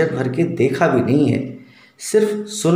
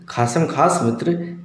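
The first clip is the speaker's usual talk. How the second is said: ordinary talk, nothing marked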